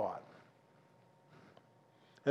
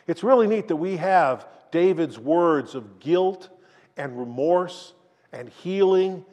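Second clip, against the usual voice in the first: ordinary talk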